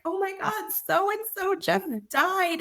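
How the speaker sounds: high, slow, choked-up mock wail; retold tearful wail. Writer tells husband a character just died, high slow tearful mock wail. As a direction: replay her own late-night crying, drawn out and high. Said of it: "I'm just crying".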